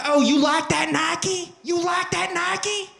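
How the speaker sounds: slow, drawn-out high holler. Acted. Redneck burning shoes at Nike.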